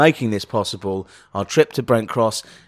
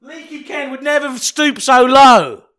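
Leaky Ken voice; 11 semitones higher, 7 dB louder than usual. Pretend.

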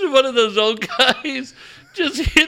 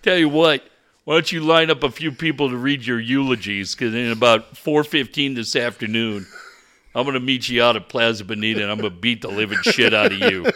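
low, fast blustering threat; coach threat; 11 semitones lower, faster than usual. Acted. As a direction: rattle it off like a mad coach.

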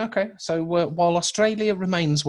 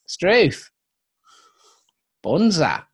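hammed-up Aussie exclamations; Australian accent; 3 semitones higher, 3 dB louder, slower than usual. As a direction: throw out slang in a mock Australian accent. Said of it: "that's my Australian news".

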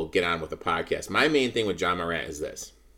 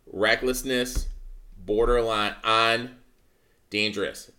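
slow emphatic; slower than usual. Real, not acted. Repeats the phrase slowly for emphasis.

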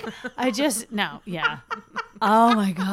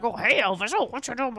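cooing to the dog; baby voice; 3 dB quieter than usual. Shown as a demonstration. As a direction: talk baby talk to a pet, lightly. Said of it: "doing the baby voice".

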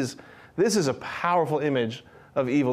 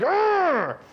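hammed-up fearful growl; 12 semitones higher than usual. George snarling as Bert comes near. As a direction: snarl like a cornered animal. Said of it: "he's like".

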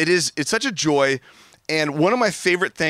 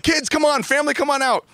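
much higher, hammed-up holiday dad calling out; Clark Griswold style; 13 semitones higher, faster than usual. Dad calls the family out to see the lights, giddy and hammed-up. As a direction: belt it like an overexcited sitcom dad. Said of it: "Clark Griswold style".

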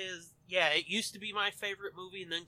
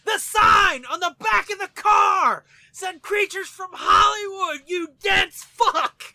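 loud, high, slow shout; 13 semitones higher, 16 dB louder, slower than usual. Pretend. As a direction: yell the line at full volume. Quotes movie line yelling, laughing.

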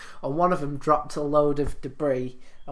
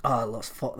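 ordinary delivery

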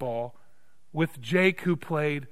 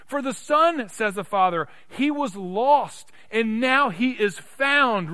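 loud, raised pulpit declaration; emphatic preaching; 9 semitones higher, 7 dB louder than usual. Real, not acted. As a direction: proclaim the good news to the congregation. Preaches at force.

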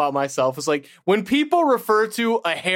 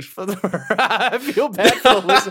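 louder, higher, laughing through it; 5 semitones higher, 4 dB louder than usual. Real, not acted. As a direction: lose it mid-sentence. Breaking into laughter mid-sentence.

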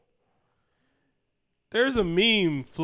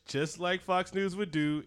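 normal talking voice

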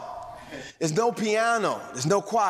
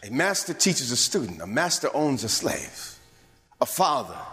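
usual speaking voice

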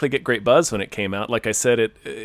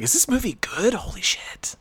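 whispering; 6 semitones higher, 5 dB quieter than usual. Retold or imitated. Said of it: "It's like".

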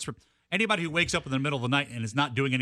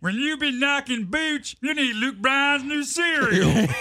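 mock ad shout; 8 semitones higher, much slower than usual. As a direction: bellow it like a cheesy commercial. Mock cereal ad.

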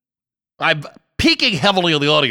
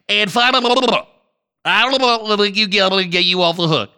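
ordinary talk, nothing marked

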